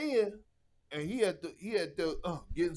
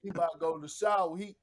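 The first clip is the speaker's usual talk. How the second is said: normal talking voice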